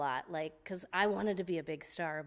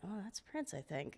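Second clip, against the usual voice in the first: quiet aside; 11 dB quieter than usual. Retold or imitated. Private aside on hearing herself.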